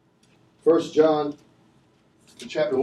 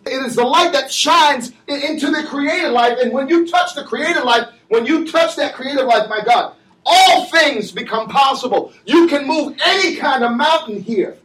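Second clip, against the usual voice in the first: fiery pulpit cadence, shouting the key words; preaching at full force; 5 semitones higher than usual. Acted. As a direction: drive it home to the congregation.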